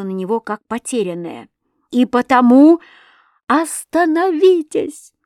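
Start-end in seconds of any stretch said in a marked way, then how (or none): 1.9-5.1 high, loud, slow cry; a frightened girl screaming at him to stop; shouting. A narrator's reading. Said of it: "закричала я".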